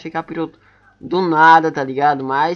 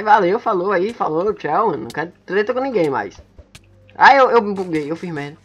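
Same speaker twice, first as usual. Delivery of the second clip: normal talking voice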